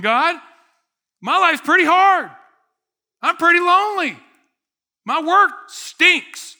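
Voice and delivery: very loud, high-pitched hammed-up complaint; entitled griping shout. Entitled grumbler yelling at God about his hard life, indignant. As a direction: holler up at God with mock indignation.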